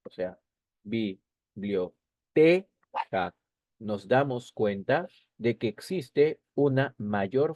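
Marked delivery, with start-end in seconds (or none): 0.8-2.0 "biblioteca" slowly broken into separate syllables; teacher's slow syllabified "biblioteca", stressing the TE. Shown as a demonstration. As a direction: sound out "biblioteca" in pieces, bi-blio-te-ca. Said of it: "Si nosotros la pronunciamos silabeando".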